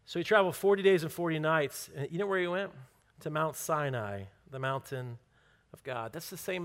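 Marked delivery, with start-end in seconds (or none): none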